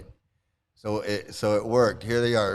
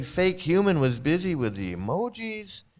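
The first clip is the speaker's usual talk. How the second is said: usual speaking voice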